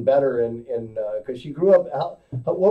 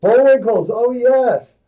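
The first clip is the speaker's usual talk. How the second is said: excited; 6 semitones higher, 6 dB louder, slower than usual. Real, not acted. Excited echo of the name.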